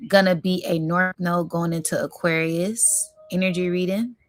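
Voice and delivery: ordinary talk, nothing marked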